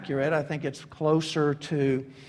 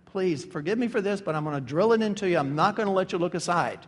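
ordinary delivery

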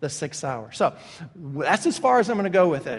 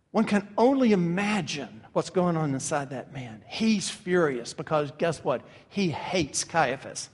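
ordinary talk

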